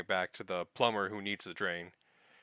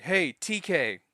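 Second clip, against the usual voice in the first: retold calling out; 7 semitones higher, 5 dB louder, slower than usual. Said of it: "she goes up, he's like".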